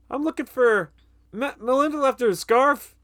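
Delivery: high-pitched, halting cover story; flustered excuse. Retold or imitated. Vic caught by Don, making up an excuse, high and flustered. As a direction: imitate someone scrambling for an alibi. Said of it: "he's not in control".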